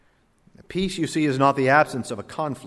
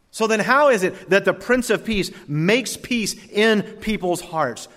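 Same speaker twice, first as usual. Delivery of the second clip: rising, louder preacher's cadence; preaching; 5 semitones higher, 6 dB louder, slower than usual. Acted. Preacher building his point to the congregation, loud sermon cadence. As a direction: preach it out with force.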